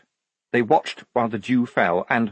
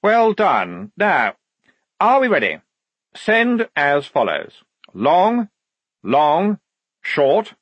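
high, raised officer's voice; commanding lieutenant; 8 semitones higher, 5 dB louder, slower than usual. Acted. Lieutenant ordering the message sent, crisply commanding. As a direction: call each signal out separately.

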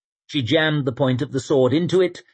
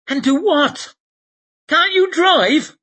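panicked man's voice; 5 dB louder than usual. Acted. Temple panics at reins.

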